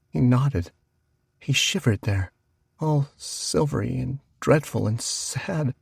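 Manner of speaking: usual speaking voice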